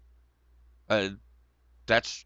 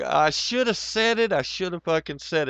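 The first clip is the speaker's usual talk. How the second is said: higher, louder burst of real regret; excited regret; 7 semitones higher, 4 dB louder than usual. Real, not acted. Telling viewers he should have said the joke, excited and pitched up. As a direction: blurt it out, kicking himself.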